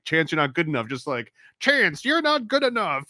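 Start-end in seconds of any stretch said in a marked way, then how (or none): 1.6-3.0 high, hammy cartoon villain; plotting Saturday morning cartoon villain. Shown as a demonstration. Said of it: "Saturday morning cartoon villain voice".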